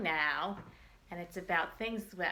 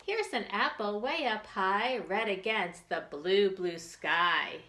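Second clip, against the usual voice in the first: put-on reading to children; 3 semitones higher than usual. Teacher reads picture book to children.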